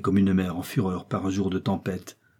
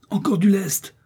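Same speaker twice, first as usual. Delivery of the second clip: high, urgent shouted order; urgent raised cry; shouting; 10 semitones higher, 4 dB louder than usual. A narrator's reading. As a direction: call it out to a crewmate. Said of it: "cria le docteur à Joey".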